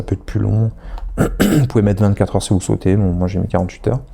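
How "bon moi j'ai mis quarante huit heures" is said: ordinary talk, nothing marked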